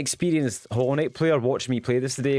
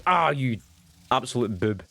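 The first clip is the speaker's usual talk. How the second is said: usual speaking voice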